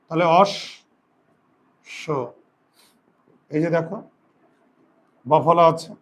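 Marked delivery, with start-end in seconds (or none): 0.4-2.0 অস, স split in two, sh sounded twice; অস, স as "osh... sho"; doubled s sound. Shown as a demonstration. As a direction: hold the sh of অস, pause, then "sho" for স. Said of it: "সটা দুইবার উচ্চারণ হবে".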